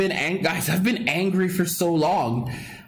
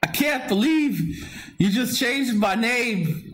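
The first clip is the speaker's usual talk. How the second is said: higher, half-joking tearful disbelief; choked up; 8 semitones higher than usual. Real, not acted. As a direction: sound close to tears over the new name. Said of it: "I feel like tearing up".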